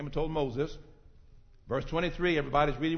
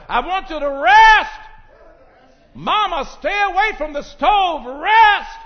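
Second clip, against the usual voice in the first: high, loud, urgent preaching; fiery preaching; 14 semitones higher, 16 dB louder than usual. Acted. Preacher urging the congregation to rest. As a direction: preach rest over them like a command.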